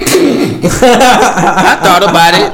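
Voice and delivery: laughing slowly through it; drawn-out giggling admission. Real, not acted.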